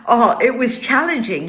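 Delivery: ordinary talk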